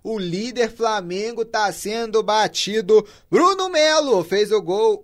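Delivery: loud, high and slowed goal announcement; explosive goal commentary. Real, not acted. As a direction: break the news of the goal at full voice. Announcing a surprise goal elsewhere. (narração explosiva de gol)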